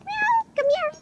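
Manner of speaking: very high coaxing pet call; squeaky lure for the kitten; calling kitty. Real, not acted. Calling the kitten over in a very high voice.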